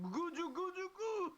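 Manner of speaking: high, loud cooing goo goo; baby talk. Pretend.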